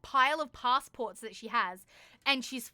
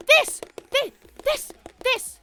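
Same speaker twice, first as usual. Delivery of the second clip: high, loud stabbing repetitions, then easing; frantic insistence; 9 semitones higher, 7 dB louder than usual. Retold or imitated. Traveller smacking the itinerary before the clerk, frantically insistent. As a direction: hammer each word in exasperation. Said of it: "I'm smacking it".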